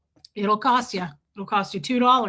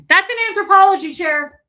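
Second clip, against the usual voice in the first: louder, higher call to the pet; sharp, raised voice at the pet; scolding pet; 7 semitones higher, 7 dB louder, faster than usual. Real, not acted. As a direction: tell the cat off from across the room. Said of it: "Notice when I yell at him".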